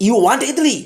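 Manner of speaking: "You want idli" pitched high as a rhetorical question; rising pitch. Shown as a demonstration. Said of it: "rising pitch". ("You want idli" চড়া, প্রশ্নের সুরে)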